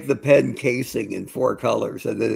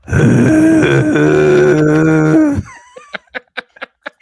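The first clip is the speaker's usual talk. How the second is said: loud, high growling bulldog noise; gruff bulldog voice; 13 dB louder than usual. Pretend. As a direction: bark it out like a bulldog. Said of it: "My bulldog impression".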